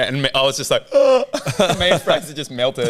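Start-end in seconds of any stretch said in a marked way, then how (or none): 1.0-1.7 high, loud gasp; mock shocked gasp. Friend frozen by the officer's question, mock-panicked gasp.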